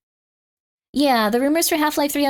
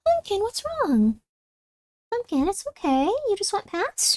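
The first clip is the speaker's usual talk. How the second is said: softer pitched-up pet voice; cooing to cat; 8 semitones higher, 5 dB quieter, slower than usual. Real, not acted. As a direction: coo gently at the cat.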